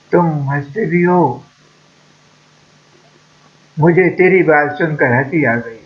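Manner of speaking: ordinary delivery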